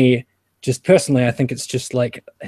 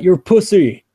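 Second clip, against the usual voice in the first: higher, louder taunt; mock jeering; 6 semitones higher, 7 dB louder than usual. Male friends answering a harassed man, mock jeering. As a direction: jeer like his scornful mates.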